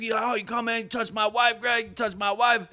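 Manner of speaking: high, loud retold confrontation; mock outraged husband yelling; imitating angry accuser. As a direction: push the pitch up and hurl accusations. Armed man accuses Greg about wife.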